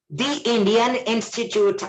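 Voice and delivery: "the" said "dee" before Indian. Shown as a demonstration. Teacher giving an example before a vowel sound, "the" pronounced "dee". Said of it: "we pronounce T H E as di".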